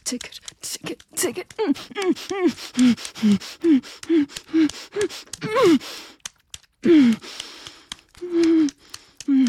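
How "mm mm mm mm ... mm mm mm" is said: loud, high moans building to a peak; loud climactic moans; climax moans. Acted. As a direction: let the moans peak and fade. Climax moaning.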